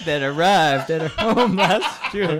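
reading through giggles; barely holding back laughter; laughing. Real, not acted. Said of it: "I laughed through that".

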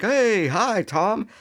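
high, singsong mock hello; boss's greeting. Boss greeting Tom as he arrives, mock-cheery. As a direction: greet an employee in a comically high voice. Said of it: "his boss is like".

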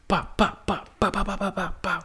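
high, fast bah bah babble in a steady rhythm; babbling cadence. Shown as a demonstration. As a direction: mimic endless chatter with nonsense syllables. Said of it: "I'm talking like this".

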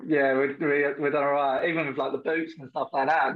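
normal talking voice

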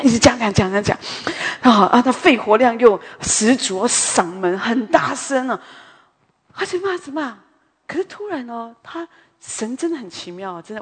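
ordinary talk

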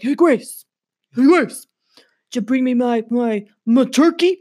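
dog voice. Pretend. Dog Megan demands turkey from Grace, in a pushy put-on voice. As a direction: voice the poodle nagging for turkey.

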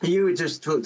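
ordinary talk, nothing marked

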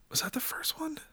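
whispered to self while searching; whispering. Real, not acted. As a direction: mutter the question under your breath. Muttering to self while googling.

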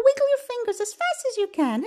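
high, rapid playful instruction; quick, high-pitched baby talk; very fast. Shown as a demonstration. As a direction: rattle it off at top speed. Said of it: "as fast as we can".

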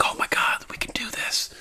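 breathless hushed whisper of awe; excited whisper. Retold or imitated. Freed slaves crowding the blades, whispering in awe. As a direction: whisper it like a secret thrill.

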